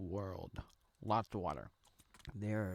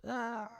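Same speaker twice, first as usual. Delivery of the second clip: high, short imitated crowd cheer; mock crowd roar; 11 semitones higher than usual. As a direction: imitate a crowd going wild. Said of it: "the crowd goes wild".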